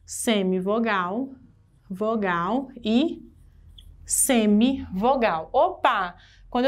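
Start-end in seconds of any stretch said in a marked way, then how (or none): 0.0-5.5 slow, spaced out. Shown as a demonstration. Teacher laying out the parts one by one. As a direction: pause between semivogal, vogal e semivogal. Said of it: "aqui eu tenho".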